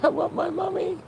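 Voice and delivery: put-on high, soft little-kid voice asking for mommy; retelling a plea from a hospital bed, small and childlike; childlike whine. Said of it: "I literally said that in recovery".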